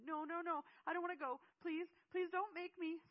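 put-on high-pitched, frantic child's yelling; desperate, very loud protest; yelled plea. A narrator's reading. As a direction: scream and beg not to go. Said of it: "Seth yelled".

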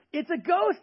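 high, frightened little shriek; mock scared cry. Disciple to crewmates, a mock scared cry. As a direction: squeal it like a spooked sailor. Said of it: "they said".

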